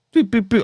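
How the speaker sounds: high mock beeping, boop boop boop; scanner beeps. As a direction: imitate a scanner rejecting the passport. Imitating airport scanner beeps.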